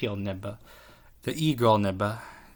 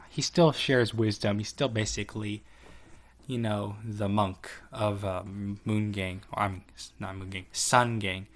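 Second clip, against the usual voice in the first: ordinary talk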